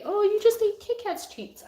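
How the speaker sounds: high, loud mock scold to the cat. Real, not acted. Owner teasing the cat who stole treats, high and loud. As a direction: tease the greedy cat in a squeaky voice.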